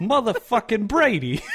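mock Boston fan. Joins Boston joke.

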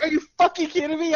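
high, fast mock indignation; imitated outrage. Girl teased about AA meeting story, imitated outrage. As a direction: blurt it as her scandalized comeback.

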